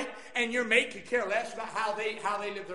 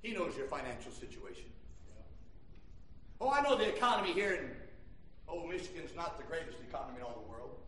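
low, soft, confiding pulpit address; hushed preaching; 9 semitones lower, 10 dB quieter than usual. Real, not acted. Preacher to Christians who just lost their job, hushed preaching. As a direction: bring it right down and speak gently to them.

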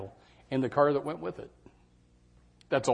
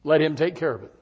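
ordinary delivery

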